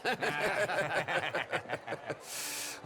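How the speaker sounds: long hearty laughter. Acted. Host laughing after the apology, hearty laugh. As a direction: laugh it all away.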